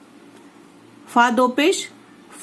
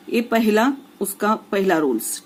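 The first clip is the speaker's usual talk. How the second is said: ordinary delivery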